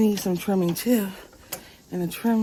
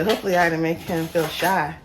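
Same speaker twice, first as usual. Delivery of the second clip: ordinary talk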